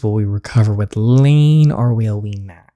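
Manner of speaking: ordinary talk, nothing marked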